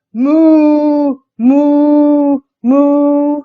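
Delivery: loud, long, sustained moos; big playful cow imitation; mooing. A narrator's reading. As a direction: moo like a storybook cow. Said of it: "the duck on the pond".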